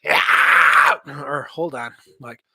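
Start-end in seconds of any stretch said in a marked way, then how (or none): 0.0-1.0 loud rasping scream; metal scream. Shown as a demonstration. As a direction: rip the word out. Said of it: "Scream would be like".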